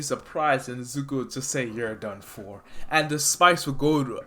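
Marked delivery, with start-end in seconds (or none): 1.7-2.6 soft, lower finisher; cold quiet line. Acted. Izuku quietly finishing off the villain. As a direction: say it with icy calm.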